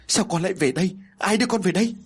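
put-on frightened mother sobbing at her child, pitch climbing; crying mother. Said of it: "không cho nó lại gần mà khóc".

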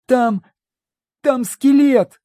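high panicked cry; frightened girl. Acted. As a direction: gasp it out in fright. Frightened girl found skeleton.

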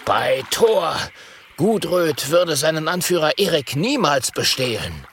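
trembling, indignant outburst; indignant cry. A narrator's reading. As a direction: cry out in wounded pride. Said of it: "wütend ... ruft mit bebender Stimme".

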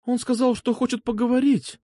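high, apologetic woman's voice; guilty schoolgirl. A narrator's reading. Alfa explains herself to Seryozha, guilty pupil voice. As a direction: answer like a pupil caught by her teacher. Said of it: "Виновата, будто она была ученицей".

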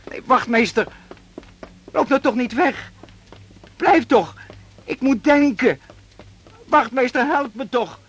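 begging the officer not to leave him alone; desperate pleading. Acted. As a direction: plead in rising panic for him to stay.

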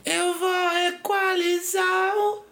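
slow, high drawn-out tease; mocking high voice. Teasing slow high drawl.

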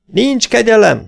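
high, loud and slow war cry; battle cry. Acted. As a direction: roar it like a charging horde. Battle cry shouted by riders. (harsányan kiáltott csatakiáltás)

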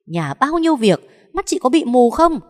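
loud, high furious mother-in-law yelling; shrill-pitched rant from an outraged matriarch; shrieking scold. A narrator's reading. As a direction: screech at the lazy daughter-in-law. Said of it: "mà tru chéo".

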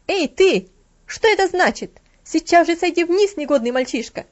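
a stern housekeeper yelling up the stairs; furious scolding shout; harsh shout. A narrator's reading. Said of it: "резкий голос внизу".

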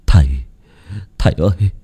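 falter, then cry out begging; stammering plea. A narrator's reading. Bloodied son begging his father, frightened stammering plea. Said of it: "miệng ú ớ".

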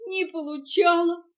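high, loud tearful confirmation; sobbing. A narrator's reading. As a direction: echo it through sobs. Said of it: "всхлипнула мартышка". (всхлипывая)

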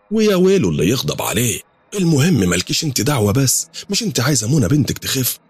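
scolding. Acted. Mother scolds doubter, defends sheikh.

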